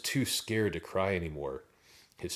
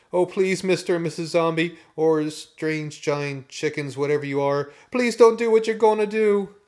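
high, loud frantic begging; terrified pleading; 10 semitones higher, 10 dB louder than usual. A narrator's reading. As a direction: plead for your life, rising to a scream. Terrified boy begs the zombies.

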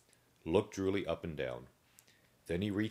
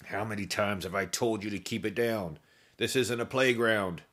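louder gruff guard growl; growled warning, louder at the end; 5 semitones higher, 5 dB louder than usual. A narrator's reading. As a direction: scold the noisy zombies through the bars.

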